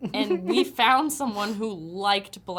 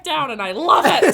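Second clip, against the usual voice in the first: mock drunk boast; 11 dB louder than usual.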